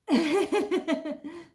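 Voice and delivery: loud, high-pitched burst of laughter. Real, not acted. Host laughs while reading chat.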